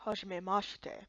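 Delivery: put-on low, soft old Kappa voice; old Kappa greeting. Old Kappa man greeting the strangers in a low soft voice. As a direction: greet them slowly like an ancient turtle elder.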